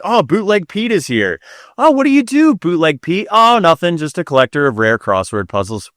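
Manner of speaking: lightly acted party guests, high-pitched openers; mock party chat. Guests greeting Bootleg Pete at a dinner party, mock party chat. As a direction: play at dinner-party small talk.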